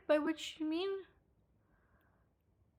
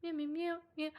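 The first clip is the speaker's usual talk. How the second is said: cat meows; 6 semitones higher, 5 dB quieter than usual. Pretend. Playful cat noises with cat ears.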